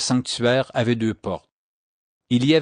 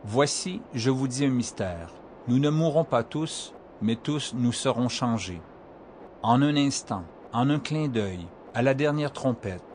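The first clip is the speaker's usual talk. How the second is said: softer, hushed scripture reading; quiet solemn reading; 6 dB quieter than usual. Acted. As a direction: read it gently, almost hushed.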